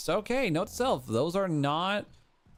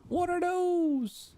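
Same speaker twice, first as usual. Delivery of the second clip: squeaky high-pitched disbelief; high squeak; 14 semitones higher, slower than usual. Real, not acted. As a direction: squeal it in shock. Sudden high squeak at dino.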